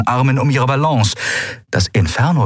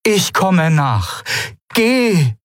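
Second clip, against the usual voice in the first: slow high voiced reassurance turning to order; Aylan insisting; 10 semitones higher, much slower than usual. A narrator's reading. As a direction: reassure slowly, then command. Aylan stays behind.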